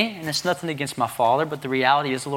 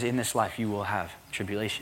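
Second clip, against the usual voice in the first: low, quiet voice of Jesus; soft scripture quote; 5 semitones lower, 8 dB quieter than usual. Retold or imitated. Preacher quoting Jesus to the congregation, low and soft. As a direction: lay it down gently and low.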